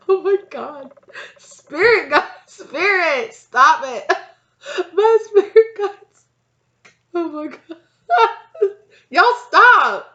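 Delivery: real high-pitched, loud laughing outburst; cracking up and squealing for them to stop; laughing protest. Real, not acted. Reader scolding her spirit guides for teasing, laughing protest.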